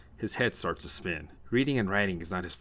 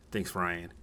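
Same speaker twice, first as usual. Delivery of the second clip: plain reading voice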